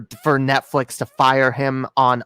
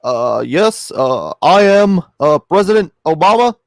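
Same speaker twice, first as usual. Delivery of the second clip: Obama; 2 semitones higher, 5 dB louder than usual. Acted. Co-host showing anyone can do a bad impression, halting presidential voice. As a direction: pause on uhs, stretch each phrase out. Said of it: "anyone can do a bad Obama impression".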